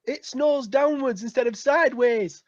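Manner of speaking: mock Yorkshire accent. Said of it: "different Yorkshire accents".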